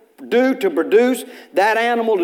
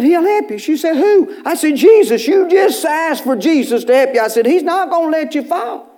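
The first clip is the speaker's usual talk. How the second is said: retold fervent urging; 8 semitones higher, 4 dB louder than usual.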